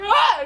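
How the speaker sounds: short, high, loud yelp; mock panicked "ah!"; freaking-out scream. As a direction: yelp as if losing it. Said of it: "like, freaking out".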